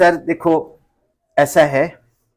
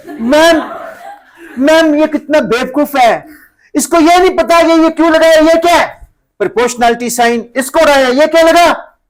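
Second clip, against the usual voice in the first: put-on high, loud, tattling kid voice; shrill snitch showing off to teacher; teacher's pet mocking; 10 semitones higher, 6 dB louder than usual. (Ma'am के चमचे की तरह ऊँची तेज़ चुगली)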